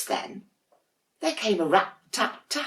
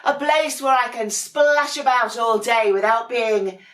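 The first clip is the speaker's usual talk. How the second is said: higher and louder, delighted; happy penguin; 7 semitones higher, 7 dB louder than usual. Acted. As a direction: gloat over your own little house. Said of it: "said Dudley".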